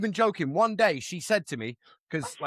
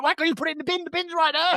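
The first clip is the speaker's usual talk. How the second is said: exasperated nag; 9 semitones higher than usual. Retold or imitated. Mimicking an annoyed nagging quote.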